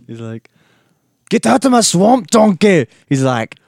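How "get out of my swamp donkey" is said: loud, much higher mock ogre shout; Shrek impression. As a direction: bellow it as a hammed-up Shrek. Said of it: "Let me do you a line from the famous, uh, Shrek".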